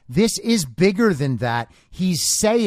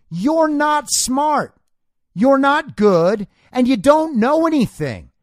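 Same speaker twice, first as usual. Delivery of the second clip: scolding; 7 semitones higher, 4 dB louder than usual. Real, not acted. Host scolding caller Kami directly.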